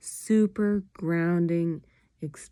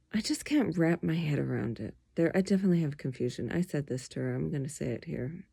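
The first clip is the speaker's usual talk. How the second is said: ordinary talk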